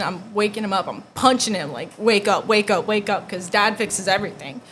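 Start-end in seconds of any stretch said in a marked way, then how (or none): 2.0-3.3 loud, repeated urgent plea; urgent wake-up call. Retold or imitated. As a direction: rouse a sleeper with raised voice. Waking dad in emergency, retold.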